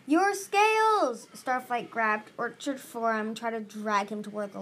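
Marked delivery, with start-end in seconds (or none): 0.1-1.3 high, loud, stretched-out cry; urgent exclamation. A narrator's reading. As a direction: shout it slowly as if it were obvious.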